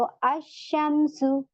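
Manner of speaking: अशमसु very slow, with the a going straight into a doubled sh; silent lam. Shown as a demonstration. Teacher shows the students a sun letter. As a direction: stretch अशमसु without any l. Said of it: "अश direct मैं हमजा को शीन में मिला रही हूँ".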